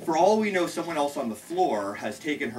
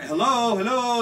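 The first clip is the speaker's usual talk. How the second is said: higher-pitched searching hello; re-enacted call to see who's home; 9 semitones higher, 4 dB louder than usual. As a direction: call into an empty apartment. Calling into a silent apartment.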